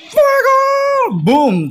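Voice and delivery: high, loud fire shout; shouted battle cry. Real, not acted. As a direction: yell it as you launch. Firing missile in game. (grito de batalla agudo)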